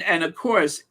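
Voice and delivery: usual speaking voice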